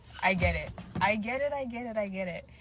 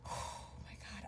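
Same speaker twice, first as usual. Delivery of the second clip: very soft sigh of disbelief; hushed, swooning exhale; overwhelmed whisper; 17 dB quieter than usual. Real, not acted. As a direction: sigh it out almost voiceless. Swooning over a beautiful woman.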